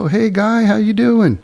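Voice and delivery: a lightly hammed-up slick guy saying hi; imitating the thief. Thief answers, voiced by narrator.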